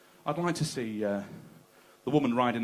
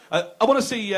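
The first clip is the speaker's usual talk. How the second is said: ordinary delivery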